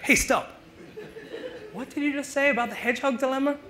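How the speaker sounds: high, urgent call to a stranger; startled passerby. Pretend. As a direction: stop someone in the street, amazed. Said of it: "you would go back and be like".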